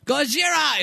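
high, hammed-up Japanese accent; caricatured Japanese movie cry; mock Japanese accent. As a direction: call it out in an exaggerated fake Japanese accent. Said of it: "If you say it really, really racist-like".

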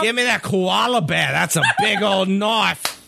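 high, hammed-up Aussie with a laugh breaking in; mock Australian accent. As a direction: play a silly Australian, stretching the last words. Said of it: "Down under".

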